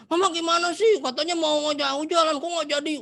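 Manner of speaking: high, loud complaining whine; angry child. Shown as a demonstration. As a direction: snap at mum for breaking a promise. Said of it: "Tapi kalau kamu". (anak protes marah ke mama dengan suara tinggi dan keras)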